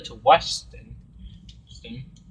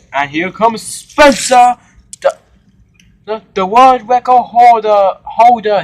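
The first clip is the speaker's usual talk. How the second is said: announcer; 10 semitones higher, 13 dB louder than usual. Acted. Reporter announces Spencer on camera.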